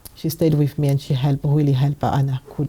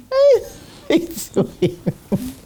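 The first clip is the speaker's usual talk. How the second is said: laughing; 11 semitones higher, much slower than usual. Real, not acted. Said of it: "laughs while retelling".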